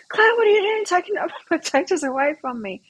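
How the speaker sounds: high, laughing protest at the thief of her plate; friend's indignant complaint. Retold or imitated. Friend at the bar protesting Claire took the potatoes, high mock outrage. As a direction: squeal it out, half laughing, outraged. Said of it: "she's like".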